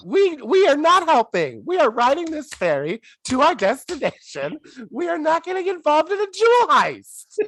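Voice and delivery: high, emphatic comic indignation; indignant scolding. Acted. Lecturing film characters against joining in, indignant scolding.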